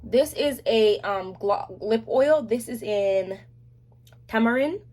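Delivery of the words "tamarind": tamarind with a Jamaican lilt; Jamaican accent. Shown as a demonstration. To viewers, showing her Jamaican accent on tamarind. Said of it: "would you say that without the accent?".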